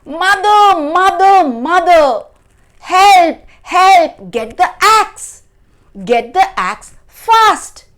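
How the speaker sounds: high, loud, urgent cries; screaming for help. A narrator's reading. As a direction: yell for mother as if chased. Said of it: "he screamed out".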